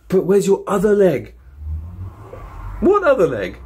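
put-on piping children's voices; kid voice. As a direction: pester like curious kids. Kids quizzing Joe.

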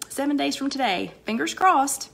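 ordinary talk

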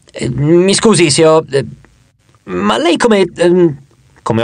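slow, halting start with filled pauses; hesitant stammering. A narrator's reading. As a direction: fumble for words, politely puzzled. Flustered narrator fumbles his question. (esitante, cercando le parole)

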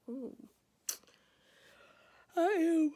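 ordinary talk

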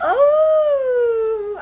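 high, loud "Oh" of pure delight; thrilled cry. Real, not acted. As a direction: cry out with real joy, up high. Thrilled at the gift.

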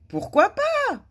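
high, loud breezy retort; exclaiming. A narrator's reading. Madame Bonheur answers the outraged king, exclaiming. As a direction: toss it back as an exclamation. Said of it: "s'exclama madame Bonheur".

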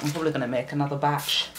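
normal talking voice

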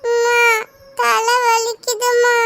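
put-on a sick child complaining of a headache; feverish whine.

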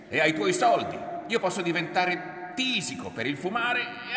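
high, very loud accusing tirade; enraged shouting, pushed high and hard; furious yell. A narrator's reading. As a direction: scream your grievance at them. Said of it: "Ettore urlò".

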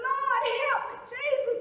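high, very loud cry for help; desperate prayer. Acted. Woman calling on Jesus as she is beaten, desperate cry. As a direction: cry out to God at full voice.

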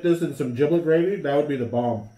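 chewing while talking; talking around food; mouth full. Real, not acted. Seen 0: Left speaker holds sandwich, mouth working food while speaking.

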